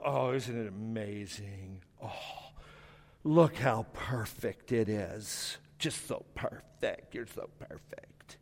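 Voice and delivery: put-on slow, soft breathy coo to a baby. People gazing at a newborn, hushed slow cooing. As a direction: whisper sweet adoration to a newborn. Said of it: "we'll go".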